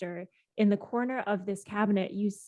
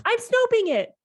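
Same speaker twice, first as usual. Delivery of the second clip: loud, high-pitched indignant insistence; exasperated, raised-voice self-defence; huffy; 14 semitones higher, 6 dB louder than usual. Acted. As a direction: insist over the interruption. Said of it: "He stows his phone huffily".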